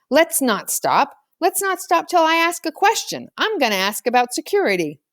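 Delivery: Marshall's voice. A narrator's reading. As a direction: insist like a determined small kid.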